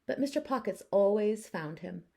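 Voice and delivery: plain reading voice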